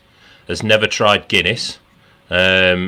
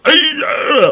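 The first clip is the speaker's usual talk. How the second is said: much higher put-on voice; Paddy's reply. Paddy answering the bullies, in a high silly voice. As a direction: squeak it out in a daft voice. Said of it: "And he'd go".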